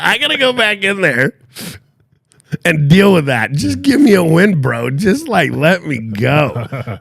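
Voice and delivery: hammed-up begging, pitched high and loud; mock pleading. Beaten-down husband begging for a win, mock pleading. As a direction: imitate a harangued husband begging to be let off. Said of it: "pleading with me in his eyes".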